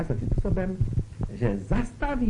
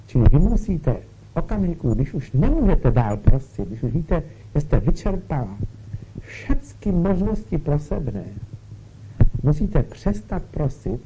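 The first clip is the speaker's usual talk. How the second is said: louder, urgent sermon push; forceful preaching; 2 semitones higher, 5 dB louder than usual. Acted. Preacher urges the listeners to surrender the sick, forcefully. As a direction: drive the point home to the congregation.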